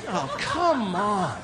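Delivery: normal talking voice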